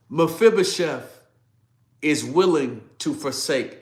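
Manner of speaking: high, loud, emotional preaching; moved to tears. Real, not acted. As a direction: proclaim it loudly through rising emotion. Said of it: "brings tears to my eyes".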